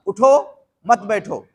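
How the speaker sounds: उठो said alone, a break, then मत बैठो joined; pause after उठो. Shown as a demonstration. Preacher showing listeners one of two readings, pause after उठो. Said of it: "इसको पढ़ने के दो तरीके हैं".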